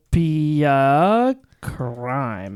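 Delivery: drawn-out words. Real, not acted. Host typing guest's account name, slow drawn-out reading. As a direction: sound it out slowly while typing.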